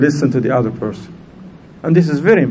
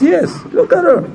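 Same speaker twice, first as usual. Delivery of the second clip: high-pitched, insistent plea; acted-out pleading young student; emotionally moved apprentice; 13 semitones higher than usual. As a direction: insist on her case, pitch jumping up. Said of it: "Emotionally by the woman".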